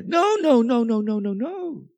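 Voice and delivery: sudden high insistent no; emphatic no. Real, not acted. Preacher insisting to his listeners that God never left. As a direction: cut the idea off hard.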